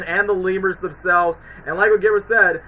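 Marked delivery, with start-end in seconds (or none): none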